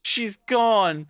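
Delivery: high, slow, dragged-out lament; telling the president, slow and high in mock despair; dramatic despair. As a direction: wail it like tragic news. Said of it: "she's like".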